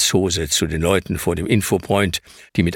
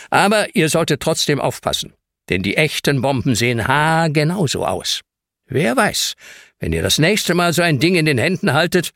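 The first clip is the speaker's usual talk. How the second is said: a hint of a lecturing man, higher; lightly voiced stern caretaker; 7 semitones higher than usual. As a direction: scold the kids like a self-important caretaker.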